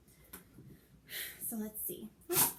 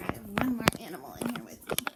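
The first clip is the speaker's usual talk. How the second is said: whisper; 7 dB louder, faster than usual. Real, not acted. Secret reveal of toy.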